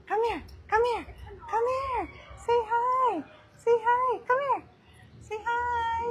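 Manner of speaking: very high sing-out coaxing. Real, not acted. Owner getting Scout to say hi, high-pitched pet talk. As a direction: coax a dog in a high little voice. Coaxing dog to greet viewers.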